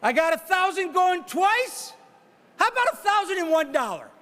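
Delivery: brisk auctioneer patter pushing for the next bid; auctioneer bid calling. Real, not acted. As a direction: call the bids like a charity auctioneer.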